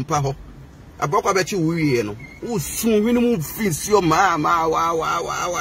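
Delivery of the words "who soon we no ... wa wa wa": ordinary talk, nothing marked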